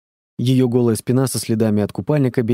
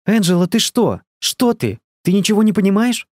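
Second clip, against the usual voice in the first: shouting; 8 semitones higher than usual. A narrator's reading. Elsa snatching the phone, yelling at her daughter. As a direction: scream down the phone in alarm. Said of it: "закричала Эльза".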